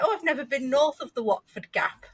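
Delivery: a hint of a sniffy London lawyer; snooty barrister. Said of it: "I've worked with some barristers who are like".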